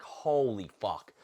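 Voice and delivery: drawn-out, slow "Holy fuck"; exasperated at a food scale in a restaurant, slowly; exasperated disbelief. Retold or imitated. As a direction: stretch it out in weary disbelief. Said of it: "I'm like".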